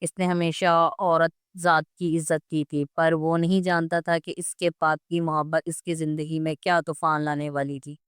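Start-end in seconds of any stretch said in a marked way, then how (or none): none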